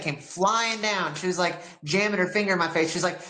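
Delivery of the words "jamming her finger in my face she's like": usual speaking voice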